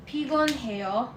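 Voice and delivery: PIGON HEO drawn out syllable by syllable; measured teaching demo of pigon heo; slow model pronunciation. Shown as a demonstration. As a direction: say pigon heo slowly for learners to copy. Teacher slowly models the word.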